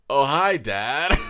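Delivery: slow, loud, tipsy "hi Dad"; tipsy greeting. Retold or imitated. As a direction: play it drunk and innocent. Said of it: "You sound like an early two thousands guy".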